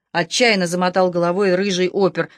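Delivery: plain reading voice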